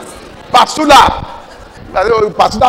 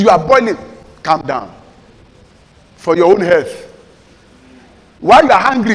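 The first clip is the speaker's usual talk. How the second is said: ordinary talk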